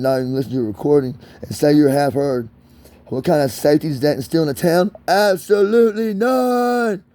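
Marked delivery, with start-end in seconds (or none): 5.1-7.0 high, drawn-out cry of indignation; emphatic outburst. Real, not acted. Answering his own question on town safety, high emphatic outburst. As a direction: stretch both words out slowly at a high pitch.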